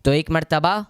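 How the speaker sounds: solemn majlis address. Acted. Opens a new majlis address.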